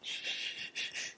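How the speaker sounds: sharp unvoiced shh, shh; shushing. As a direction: hush them with a hiss. Shushing someone at graduation.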